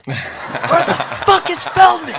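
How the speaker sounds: high, loud, slow retold yell, half laughing. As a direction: belt the second ask out like a rockstar losing patience. [name] repeats it, yelling.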